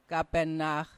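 plain reading voice

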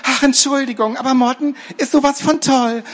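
very high, squeaky adoring gush; starstruck Gerti. Acted. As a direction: swoon over your hero. Gerti gushing over Morten.

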